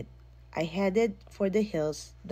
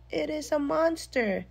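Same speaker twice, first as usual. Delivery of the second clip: bawling; 10 semitones higher than usual. A narrator's reading. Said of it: "I bawled".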